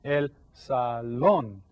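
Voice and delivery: "salón" slowed right down, "lón" stressed; slow teaching demo of "salón", final syllable strong; stress on last syllable. Shown as a demonstration. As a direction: draw out "salón" word by word, landing on "lón". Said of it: "So you stress that syllable".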